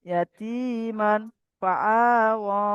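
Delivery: ইয়াতীমান ফাআওয়া drawn out slowly, the nun hummed through the nose; nasal ikhfa recitation. Shown as a demonstration. Teacher reciting for students, slow nasal ikhfa. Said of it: "আওয়াজটাকে নাকে তুলে গুণা করতে হবে".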